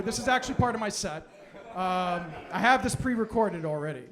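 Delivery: usual speaking voice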